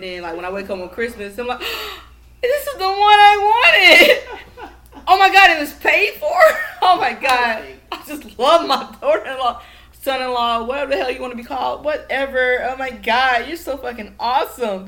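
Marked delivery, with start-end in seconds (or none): 1.7-2.4 mock gasp. Acting out Christmas surprise. 2.4-7.6 high, loud, slow overjoyed shriek; hammed-up delighted squeal. Imagining Christmas morning, screaming with hammed-up joy. 7.9-14.9 mock gushing. Pretend thanks to in-law.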